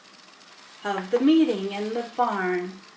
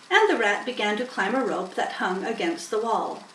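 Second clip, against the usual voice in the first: plain reading voice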